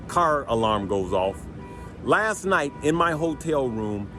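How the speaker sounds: ordinary talk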